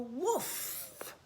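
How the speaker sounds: voiced woof of a farm dog; dog bark. Dog noise in picture book.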